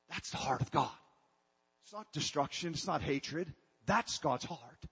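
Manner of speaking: usual speaking voice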